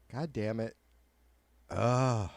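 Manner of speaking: ordinary delivery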